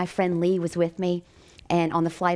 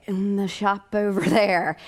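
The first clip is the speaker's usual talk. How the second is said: slow, drawn-out imitation of a puzzled man; retold bewildered answer; baffled reply; 3 semitones higher, slower than usual. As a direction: answer slowly, as if to someone strange. Baffled man answers her demand.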